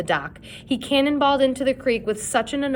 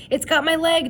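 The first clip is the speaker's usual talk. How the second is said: high, louder pained scream; 8 semitones higher, 4 dB louder than usual. A narrator's reading. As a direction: scream as the shark bites. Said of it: "Chet screamed".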